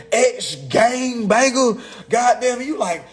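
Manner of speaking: ordinary delivery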